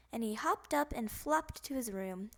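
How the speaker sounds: plain reading voice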